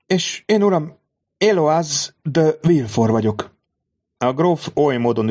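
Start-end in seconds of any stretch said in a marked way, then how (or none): 0.1-3.6 high, slow, dignified lady; formal introduction. A narrator's reading. Mother introduces herself to the count, gracious and slow. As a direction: present herself with grace.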